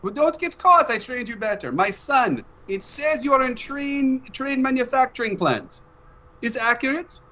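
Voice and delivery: a doting father checking on his son; Papa on the phone. Acted. Papa calls his son mid-mission, high accented voice.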